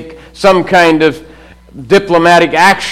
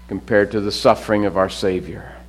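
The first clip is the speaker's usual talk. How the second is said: ordinary talk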